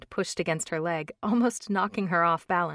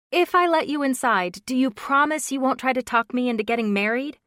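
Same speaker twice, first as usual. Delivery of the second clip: put-on young woman voice; 7 semitones higher, 7 dB louder than usual. A narrator's reading. Brooke, bargaining.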